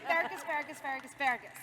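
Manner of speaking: loud repeated calling of Fergus, easing off; on request, calling Fergus over and over; repeated calls. Pretend. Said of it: "could you yell Fergus?".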